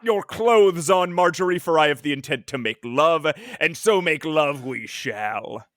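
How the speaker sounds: hammed-up alien courting his wife; villainous alien voice.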